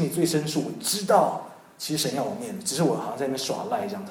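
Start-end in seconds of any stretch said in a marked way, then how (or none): none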